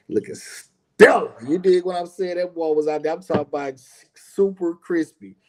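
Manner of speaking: bragging about his son, voice lifting high with pride; proud excitement. Real, not acted. Proud father praising son.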